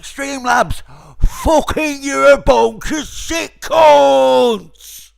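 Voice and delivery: very loud, high, slow drawn-out scream; enraged singsong yell. Real, not acted. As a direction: belt the insult out like a chant. Peak rage, stretched singsong yell.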